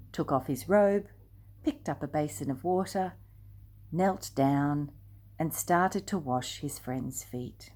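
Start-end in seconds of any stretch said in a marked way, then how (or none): none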